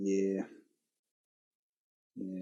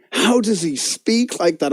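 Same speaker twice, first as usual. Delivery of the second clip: ordinary talk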